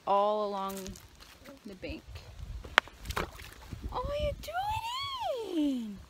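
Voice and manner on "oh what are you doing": very high-pitched sing-song question; squeaky pet-talk voice. Real, not acted. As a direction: coo it way up high. Cooing question to companion or pet.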